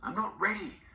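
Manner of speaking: high, breathless whispered plea; a frightened grandmother pleading not to go; terrified pleading. A narrator's reading. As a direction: beg not to be taken. Grandmother refuses in terror.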